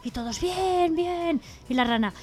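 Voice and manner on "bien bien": higher, drawn-out mock cheer; cheering crowd. Animals hear of sweets and music, cheering. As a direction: cheer along like the happy animals.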